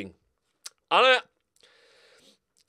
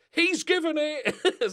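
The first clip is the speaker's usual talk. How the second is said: mock referee call; 13 semitones higher, 6 dB louder, slower than usual. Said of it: "there's no sort of".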